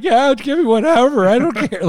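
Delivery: high, loud crying "whatever"; teary whatever. Retold or imitated. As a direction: squeak it out through tears, past caring. Retelling bawling at the vet.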